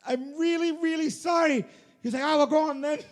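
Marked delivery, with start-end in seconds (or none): 0.1-1.6 high, raised voice pleading; pleading explanation. Retold or imitated. Naked runner explains a lost bet to manager, high pleading. 2.2-3.0 put-on high, loud Irish accent; high-voiced Irishman. Irish manager lets the runner carry on, high Irish voice. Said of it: "he had a very high voice".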